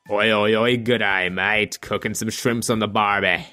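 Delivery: lightly hammed-up ocker Australian accent. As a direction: parrot the cliché Australian tourist line. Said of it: "Whenever somebody tries to imitate an Australian".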